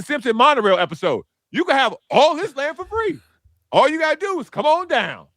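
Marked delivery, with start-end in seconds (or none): 1.5-5.3 much higher, hammed-up huckster pitch; mock salesman promising free land. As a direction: sell the free land like a showman. Hammond officials voiced like monorail salesman.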